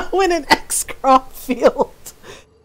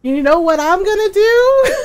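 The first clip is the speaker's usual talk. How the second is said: laughing quote; 6 semitones higher, 4 dB louder than usual. Retold or imitated. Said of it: "So you just went".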